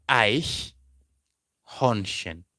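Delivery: Eichhörnchen stretched very slowly, each syllable held; drawn-out German word. Shown as a demonstration. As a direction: spell out Eichhörnchen in sound, very slowly. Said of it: "You can see this".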